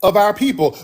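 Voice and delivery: ordinary delivery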